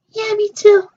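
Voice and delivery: a squeaky other doll agreeing. Pretend. Two toy characters answer in turn.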